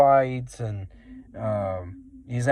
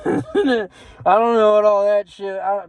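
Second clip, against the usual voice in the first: laughing; 8 semitones higher, 5 dB louder than usual. Real, not acted. Laughing at the strange ride.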